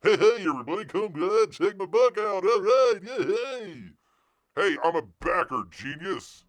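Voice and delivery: loud, high hammed-up hype; mock hype-man shout; mock condescending promo. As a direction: parody a talking-down pitch. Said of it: "talked down to".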